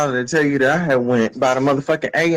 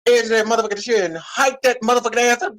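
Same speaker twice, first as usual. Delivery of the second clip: ordinary delivery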